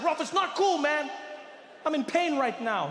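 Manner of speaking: high, upset protest to a friend; hurt protest. Acted. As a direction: object over his laughter, mock-wounded. Hurt protest in acted quarrel.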